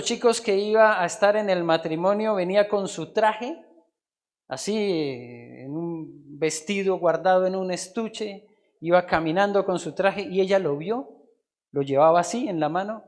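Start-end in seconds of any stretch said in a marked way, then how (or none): none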